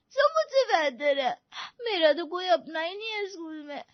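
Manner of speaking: put-on tearful child pouring out hurt; words breaking into sobs; sobbing. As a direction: cry as you confide in mother. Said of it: "सिसकती हुई मीरा".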